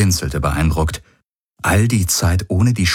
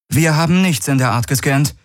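higher-pitched, wary reply; distrustful; 9 semitones higher than usual. A narrator's reading. Kirai doubts the alien's story, mistrustful. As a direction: let the mistrust show in a raised voice. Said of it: "Ihr Misstrauen war deutlich zu hören".

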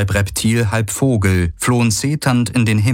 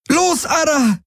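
much higher, desperate cry; desperate scream; slower than usual. A narrator's reading. Kirai screams at Ara in despair as the riders storm in. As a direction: scream him forward. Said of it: "schrie Kirai verzweifelt".

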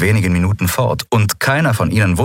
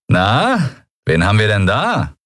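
put-on higher, teasing young rogue; amused pirate; 3 semitones higher than usual. A narrator's reading. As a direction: toy with them, amused. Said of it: "unüberhörbar amüsiert".

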